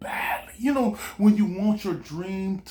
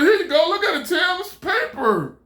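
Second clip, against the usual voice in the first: proud inventor; 10 semitones higher, 3 dB louder than usual. Retold or imitated. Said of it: "he said".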